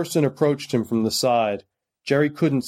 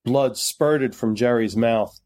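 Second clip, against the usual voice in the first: ordinary delivery, nothing marked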